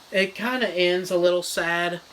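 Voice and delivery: ordinary talk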